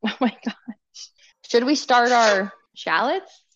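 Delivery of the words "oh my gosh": whispered laugh. Real, not acted.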